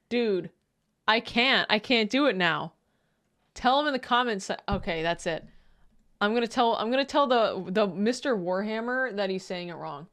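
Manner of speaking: ordinary talk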